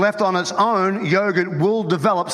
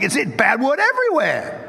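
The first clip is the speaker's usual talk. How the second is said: higher-pitched, incredulous retort; retold exasperation; 5 semitones higher than usual. Preacher retells answering his cussing friend, mock exasperated. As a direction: act out setting a friend straight, pitched up.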